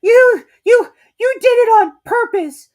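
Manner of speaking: very high, loud spluttering outrage. A narrator's reading. Piglet spluttering accusation at Kanga. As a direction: splutter through a mouthful of soap. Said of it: "spluttered Piglet".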